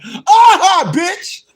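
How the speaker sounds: loud, high jeering "aha"; mocking laugh. Laughing back at the complainers on TV, loud jeer. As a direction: burst out with a gloating cackle.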